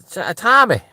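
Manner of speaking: high, loud imitation of a workmate; calling his friend. Junior calling over his workmate, high loud hail. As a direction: call out the name high and loud. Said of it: "he was like".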